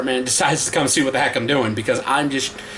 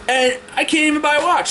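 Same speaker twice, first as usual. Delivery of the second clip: indignant; 9 semitones higher than usual. Real, not acted. Venting rant.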